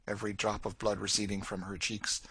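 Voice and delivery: usual speaking voice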